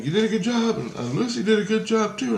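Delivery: high, praising imitation of Christ. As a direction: voice him high and pleased. Christ voiced in dream story.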